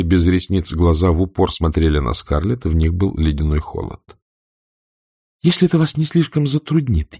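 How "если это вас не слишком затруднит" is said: higher, sugary mock politeness; sarcastic. A narrator's reading. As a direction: needle her with false courtesy. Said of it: "язвительным тоном".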